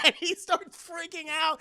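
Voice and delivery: ordinary talk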